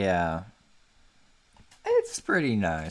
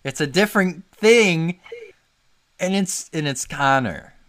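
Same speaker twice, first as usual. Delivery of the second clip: excited; 9 semitones higher, 8 dB louder than usual. Real, not acted. Excited joking insistence.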